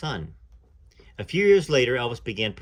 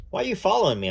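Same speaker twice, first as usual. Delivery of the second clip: a firm demand pitched high; trying to sound firm to hide fear; 9 semitones higher, 3 dB louder, faster than usual. Acted. Lucy demands why the singer follows her, firm. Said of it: "I demanded".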